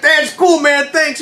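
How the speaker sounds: high, worked-up reply through pain; acted strained shout. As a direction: shout thanks while hurting. Patient answers medic while in agony.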